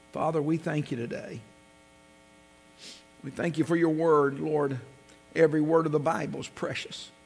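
soft, hushed pastoral prayer. Acted. Leads the congregation in prayer.

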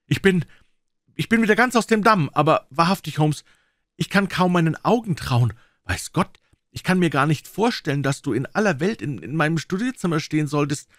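high, loud and flustered; shaken excitement. Acted. Watson, just revived from a faint, to Holmes, high flustered excitement. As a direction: stammer it out, still reeling.

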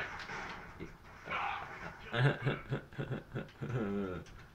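breathy run of chuckles; real stuttering laugh. Real, not acted. Gamer cracking up mid-match, laughing. Laughing after getting blown up.

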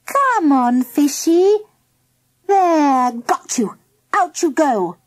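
put-on high girl's voice, coaxing the pet; busy, high-pitched coaxing; girl catching cat. A narrator's reading.

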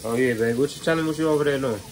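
ordinary talk, nothing marked

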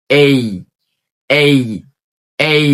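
"এই" drawn out, gliding into ee; correct pronunciation. Shown as a demonstration. Teacher drills everyone on A, "এই" with a long glide. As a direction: model "এই" slowly as ay-ee. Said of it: "উচ্চারণ করব।".

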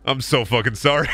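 normal talking voice

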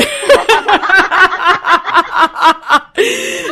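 long, loud, high-pitched laughter; helpless peals of laughter; big real laugh. Real, not acted. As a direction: laugh hard at your own punchline. Laughs at her own punchline.

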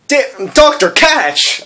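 high, slow call to the Doctor; high, drawn-out call of Doctor Catch. Acted. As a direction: toss the line up high like Rose. Rose tossing sword quote.

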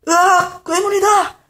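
high, loud panicked cry; frightened scream. Acted. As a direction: shriek and flee. Pigman flees screaming.

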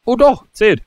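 high, loud relieved exclamation; happy surprise. Real, not acted. Gamer surprised the item counted, happy high exclamation.

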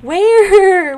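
high, loud frantic Where. Real, not acted. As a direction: call out in a panic. Can't find the cherry.

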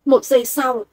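ordinary delivery

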